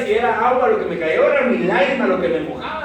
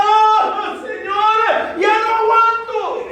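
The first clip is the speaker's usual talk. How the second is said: high, loud, drawn-out wail to God; hammed-up wailing prayer; retold tearful cry; 12 semitones higher, 4 dB louder, much slower than usual. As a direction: cry out to heaven in desperation. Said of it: "en, en llanto".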